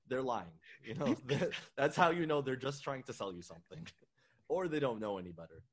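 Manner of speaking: amused, half laughing; laughing at the sales trick. Real, not acted. Laughing while calling out liars.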